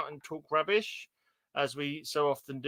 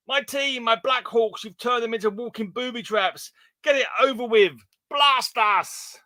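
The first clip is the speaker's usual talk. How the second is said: hammed-up heroic cry; 7 semitones higher, 4 dB louder than usual. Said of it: "they've all got bombs in their heads".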